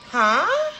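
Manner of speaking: loud, high-pitched incredulous "huh?"; shocked huh. Retold or imitated. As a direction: blurt out a stunned question, voice shooting up. Shocked by what she read.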